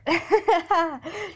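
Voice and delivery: high, breathy laugh through tears; teary laugh. Real, not acted. A tearful laugh while recalling booking the dream holiday. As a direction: let a tearful laugh break out. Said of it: "why am I literally getting emotional?".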